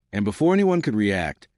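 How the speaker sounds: plain reading voice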